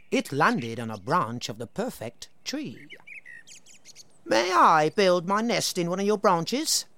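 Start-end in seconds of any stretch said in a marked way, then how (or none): 4.2-6.9 higher, polite bird voice; blackbird asking. Acted. The blackbird asking the tree for a home, polite. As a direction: ask sweetly and politely. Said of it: "said the blackbird".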